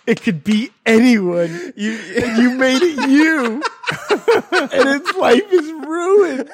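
laughing through mock tears; slow, high, laugh-crying lament. Real, not acted.

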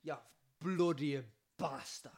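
mock-offended Scottish outburst; exaggerated Scottish scolding; put-on Scottish accent. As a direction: play the indignant Scot, drawing out the insult. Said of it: "It's very Scottish".

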